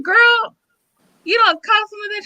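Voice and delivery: high-pitched, mock-appalled teacher; retold scolding. Teacher retelling her reply to a student, scolding disbelief. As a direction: pitch it way up in reproach.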